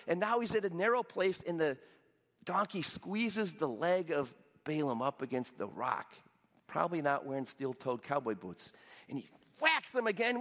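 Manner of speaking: ordinary talk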